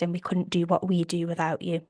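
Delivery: ordinary talk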